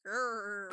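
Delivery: growl. Pretend. Tiger growl in circus tent.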